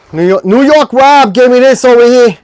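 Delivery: loud, high mock New York accent. Host shouting out New York Rob, hammed-up New York accent.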